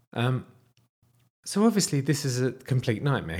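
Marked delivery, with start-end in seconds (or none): none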